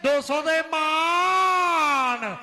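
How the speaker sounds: long stretched shout of the prize; drawn-out announcer call. Real, not acted.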